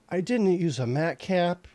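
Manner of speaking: loud, high startled realization; shocked outburst. Real, not acted. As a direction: blurt it out in disbelief. Realizes mistake mid-sculpt.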